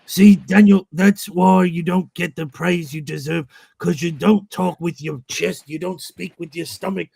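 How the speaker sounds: lightly voiced scolding father; imitating a father's lecture. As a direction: lecture him like a pushy dad, hammed up. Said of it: "He goes".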